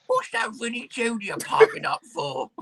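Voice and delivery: mock club owner. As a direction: imitate the owner grumbling from his mansion. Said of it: "I can imagine our owner in his mansion".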